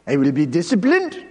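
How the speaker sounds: emphatic Eastern European accent, lifting on disciplined. Shown as a demonstration. Preacher showing how accent adds power, Eastern European accent. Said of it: "say it in an Eastern European accent".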